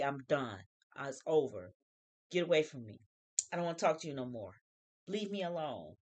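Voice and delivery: low, quiet imitation of giving up; mock dismissal. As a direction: act fed up, keeping it low and soft. Voicing a spouse giving up.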